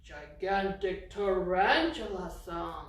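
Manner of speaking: slow, loud gigantic tarantula; drawn-out name. Shown as a demonstration. Teacher introducing the song to kids, slow and loud. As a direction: stretch out "gigantic tarantula" slowly and loudly. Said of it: "modify our Itsy Bitsy Spider song".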